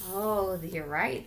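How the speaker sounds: ordinary talk, nothing marked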